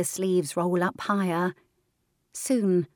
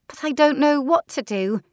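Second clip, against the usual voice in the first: put-on anxious girl; 8 semitones higher, 6 dB louder than usual. Louise pleading.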